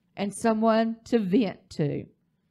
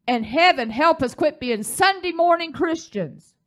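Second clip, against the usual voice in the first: higher, rising preacher's plea; emphatic exhortation; 7 semitones higher, 4 dB louder than usual. Real, not acted. Preacher pleads with congregation, emphatic. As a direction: urge the church with some force. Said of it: "Do I need to say it again?".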